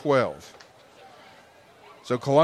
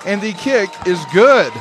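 loud, high scoring update; excited score call; 5 semitones higher, 4 dB louder than usual. Real, not acted. Extra point and score call.

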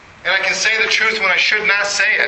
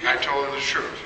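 ordinary delivery

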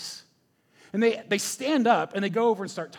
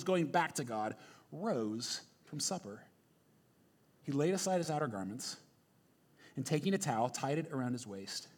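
calm Bible reading, sinking lower as the verses go on; scripture reading; 3 semitones lower than usual. Acted. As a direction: read the passage solemnly to the church. Reads scripture to congregation.

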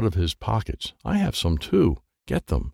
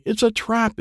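high warning cry; yell; 12 semitones higher than usual. Acted. Izzy yells a warning to the group. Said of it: "Izzy yells".

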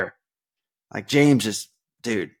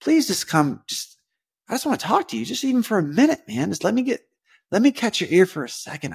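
higher, gentle coaxing invitation; patient, loving plea; 8 semitones higher than usual. Retold or imitated. The Lord gently pleading with him to spend time together, patient and loving. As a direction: lovingly beg a friend to come talk. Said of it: "patiently, lovingly".

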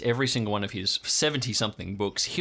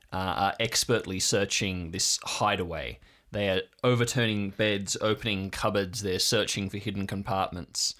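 game master narration; 4 semitones lower than usual. A narrator's reading. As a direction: paint the scene for the players, unhurried. GM sets the scene.

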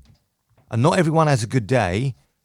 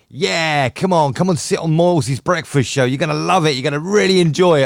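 mock-eager sales pitch, raised and loud; high, loud, hammed-up enthusiasm; eager producers; 5 semitones higher, 4 dB louder than usual. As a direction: imitate producers enthusiastically talking someone into it. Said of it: "Radio One producers was going".

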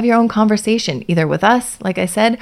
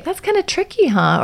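gentle sympathetic; 12 semitones higher than usual. Shown as a demonstration. Gentle phrase to child.

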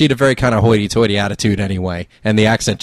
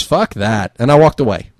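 ordinary delivery

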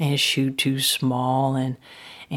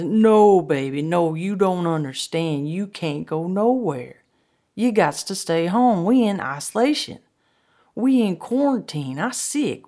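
loud refusal climbing higher; raised, emphatic warning; insistent pleading; 4 semitones higher, 5 dB louder than usual. Retold or imitated. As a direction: plead and insist, acting a worried partner. Frank insists they must stay home.

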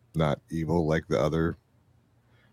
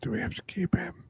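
hushed, whispered aside; 12 semitones higher, 6 dB quieter than usual. Acted. Player confiding in Trixie about the nightmare guy, whispered. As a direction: whisper it privately to your ally.

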